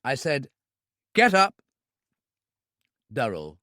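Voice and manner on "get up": sharp loud order at gunpoint; hard command. A narrator's reading. Gunman orders the club owner up, loud command. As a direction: bark it as a threat.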